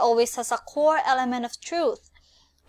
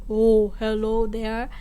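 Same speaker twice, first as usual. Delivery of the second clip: big man voice. Shown as a demonstration. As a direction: play a big burly man greeting, slow. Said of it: "then your voice should be like".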